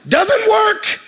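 high, loud comic shout; mock outburst. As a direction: blurt it out in a very high yell. Acted outburst after mocked objection.

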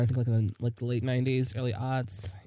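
ordinary talk

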